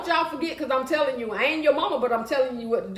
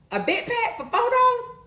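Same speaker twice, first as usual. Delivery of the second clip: much higher, disbelieving question; can't believe the price; high astonished "four dollars?"; surprised; 11 semitones higher than usual. Real, not acted. Reacting to a cheap price.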